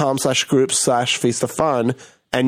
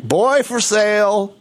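teasing dad; 6 semitones higher, slower than usual. Retold or imitated. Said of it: "my dad used to be like".

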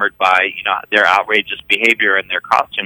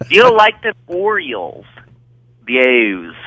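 Maryland accent. Shown as a demonstration. Showing friends the O's, in a Maryland accent. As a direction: play up the Baltimore O's. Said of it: "I'll do it with my Maryland accent".

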